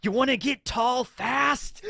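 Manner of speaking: high, loud, slow creepy clown taunt. Retold or imitated. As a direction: leer at the kid like a menacing clown. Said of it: "Buggy is so creepy here".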